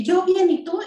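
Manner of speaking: respuesta aguda y fuerte de adulto; adulto respondiendo. Shown as a demonstration. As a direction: contesta en voz alta y aguda al niño. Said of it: "ecolalia, tú dices una frase". (respuesta aguda y fuerte del adulto)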